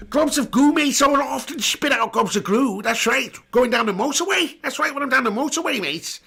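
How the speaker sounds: high, excitable Scouse caricature; mock Scouse with slushy esses; Jamie Carragher impression. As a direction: play a spitting Scouse pundit, pitched right up. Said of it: "my name's Jamie Carragher".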